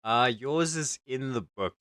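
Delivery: ordinary talk, nothing marked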